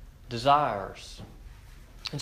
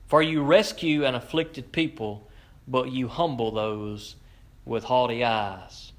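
ordinary delivery

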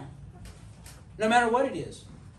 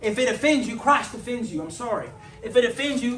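ordinary talk

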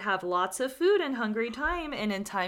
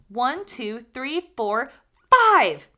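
counting up, ending in a loud high five; building count; 3 semitones higher, 10 dB louder, slower than usual. Acted. Guinea fowl counts to five.

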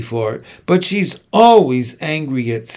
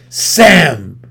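sharp, loud hiss of a name; 7 dB louder than usual. A narrator's reading. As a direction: snap her name in betrayal. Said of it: "I hiss".

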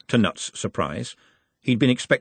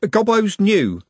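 high, louder intervention; protective; 12 semitones higher, 5 dB louder, slower than usual. A narrator's reading. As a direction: step in for your friend, pitched up. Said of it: "said Trev protectively".

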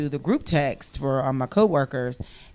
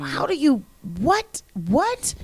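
high, broken-off shocked questions; stunned disbelief; 10 semitones higher than usual. Retold or imitated. Woman in shock at the news, high sputtering. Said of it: "Like, everything just like".

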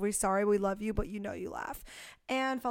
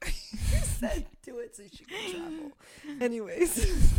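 breaking character giggling; 6 semitones higher, slower than usual. Real, not acted. Laughing while talking.

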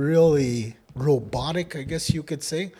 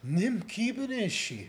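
slow, higher-pitched teaching delivery; expressive model phrase; 6 semitones higher, 8 dB quieter than usual. Shown as a demonstration. Teacher models for learner to repeat, slow expressive delivery. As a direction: model it slowly with raised pitch. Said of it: "Say it with me".

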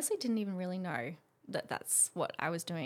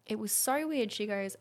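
normal talking voice

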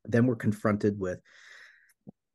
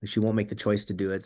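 usual speaking voice

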